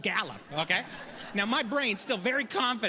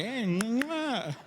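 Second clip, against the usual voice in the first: copied horse cue; 3 semitones higher than usual. Retold or imitated. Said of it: "and I'm like".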